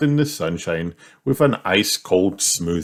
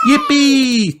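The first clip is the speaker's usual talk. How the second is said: put-on very high child's whoop; long, high piglet cheer; 4 dB louder than usual. A narrator's reading. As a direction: squeal it with glee. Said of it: "cheered Peppa and George".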